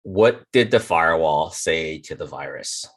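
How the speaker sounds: ordinary talk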